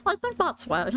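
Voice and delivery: high, giggly aside; laughing. Real, not acted. Laughing at the failed Stitch voice attempt, amused. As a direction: break into laughter while talking.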